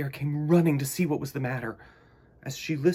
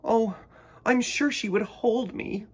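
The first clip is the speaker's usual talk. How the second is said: mild sob; 8 semitones higher than usual. Acted. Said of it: "sobbed Choco".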